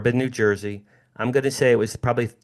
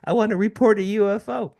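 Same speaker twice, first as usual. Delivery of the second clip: put-on high, eager boy's voice, much higher; young boy; 11 semitones higher, 3 dB louder than usual. Boy phoning the newspaper to report a UFO, high and eager. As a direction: play the thirteen-year-old reporting a UFO, pitched way up. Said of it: "I go".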